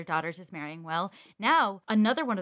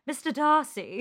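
a swooning British "Mister Darcy", dipping on "Darcy"; British accent; 5 semitones higher than usual. Retold or imitated. Cohost imitating the host's British slip, swooning British accent. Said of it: "you just went".

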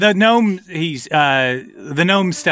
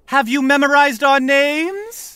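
high, slow, drawn-out question; showy little creatures in chorus; prankster chorus; 11 semitones higher than usual. Acted. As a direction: quiz them in a high gnome voice. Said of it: "in unison they say".

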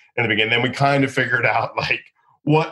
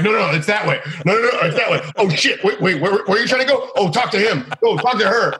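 higher, hurried misdirecting voices; flustered assistants; 10 semitones higher than usual. Retold or imitated. Assistants misleading the agent, flustered voices. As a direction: play frantic crew faking confusion. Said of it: "They're like".

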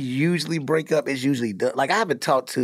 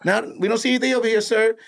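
higher, fast mock soldier voices; soldiers in movie; 9 semitones higher, faster than usual. Retelling the comb-the-desert scene in mock soldier voices. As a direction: rattle off like dutiful troops. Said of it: "they was asking for everybody".

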